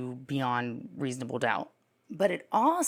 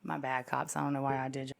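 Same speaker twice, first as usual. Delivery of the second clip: ordinary talk, nothing marked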